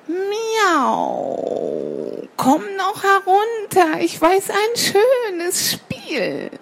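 high, drawn-out luring cat voice; coaxing cat. Acted. Cat lures little sparrow down to play, high coaxing. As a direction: stretch the meow and coax sweetly from below.

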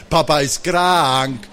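KRANK drawn out long, teasing; teasing stress. A narrator's reading. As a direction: grin as you stretch the word krank. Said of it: "rief Mama und grinste wieder so schön, besonders bei dem Wort krank".

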